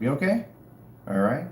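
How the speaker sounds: ordinary talk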